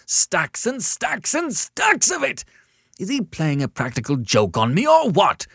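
high, loud, overwrought caller; hysterical. A narrator's reading. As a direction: wail it, then peak on a frantic demand. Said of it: "he demanded hysterically".

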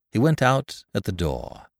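ordinary delivery, nothing marked

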